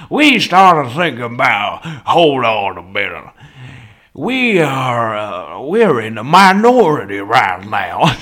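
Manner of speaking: hammed-up Southern drawl, loud bursts; Southern accent. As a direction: play a Southern orator mid-lecture. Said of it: "I just started talking like a southerner".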